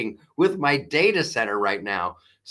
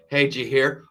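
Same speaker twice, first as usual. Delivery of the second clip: usual speaking voice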